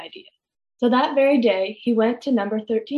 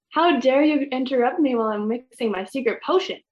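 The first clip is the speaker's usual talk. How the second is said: ordinary delivery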